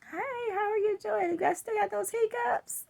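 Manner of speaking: high, eager hello; excited greeting. Retold or imitated. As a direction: greet her with bright excitement. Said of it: "I just got excited".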